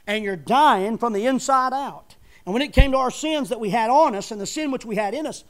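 loud pulpit preaching; fiery preaching. Acted. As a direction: preach it out to the back pews. Preaching at full force.

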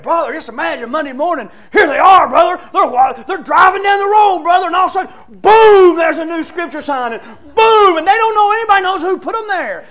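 loud, high, booming excitement; all fired up. Retold or imitated. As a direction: imitate a friend bursting with a big plan. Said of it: "He's all fired up".

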